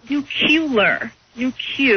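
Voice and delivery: nuclear said as nu-cu-lar, cu syllable stressed; nucular. Shown as a demonstration. Professor shows the pet-peeve version, nuclear said nu-cu-lar. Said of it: "when you call it, like, cu".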